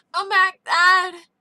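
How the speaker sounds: put-on a waking boy slurring for his father; deep slur. A narrator's reading. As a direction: call out half-asleep, slurring. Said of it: "he said with a deep slur".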